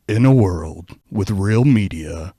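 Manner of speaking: loud, slow booming trailer read; movie trailer voice. Shown as a demonstration. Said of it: "has to do their movie voice".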